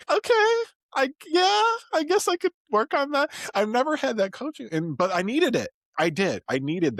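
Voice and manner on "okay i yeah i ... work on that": high, timid yes; meek agreement. Retold or imitated. Retold meek reply to leader.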